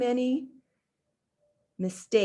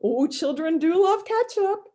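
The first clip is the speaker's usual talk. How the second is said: high, bright-eyed maid voice, raised; higher, louder character voice for the maid; Amelia Bedelia voice; 9 semitones higher, 4 dB louder than usual. Acted. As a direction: chirp it out like a cheerful maid.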